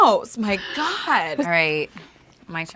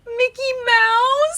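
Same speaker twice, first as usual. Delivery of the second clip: high squeaky cartoon mouse voice; Mickey Mouse voice; 4 dB louder, slower than usual. Shown as a demonstration. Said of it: "Channel your inner".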